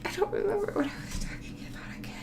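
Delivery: fast, breathy whispered aside. Real, not acted. Forgetting the topic mid-vlog, fast whisper. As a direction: mutter it to yourself, lost. Lost her train of thought.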